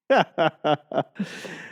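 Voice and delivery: string of quick chuckles; amused laugh. Real, not acted. Laughing after voicing own body, amused chuckle. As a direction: crack up at your own bit.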